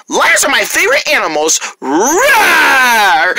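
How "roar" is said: mock lion roar; playful lion roar.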